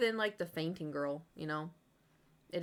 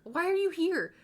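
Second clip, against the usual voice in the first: panicked confusion; 10 semitones higher, 5 dB louder than usual. Retold or imitated.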